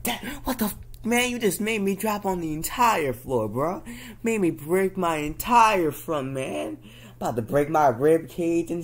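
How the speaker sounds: high, aggrieved mock outrage; indignant protest. Child to dad after falling out of bed, indignant protest. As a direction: complain in a high, worked-up voice.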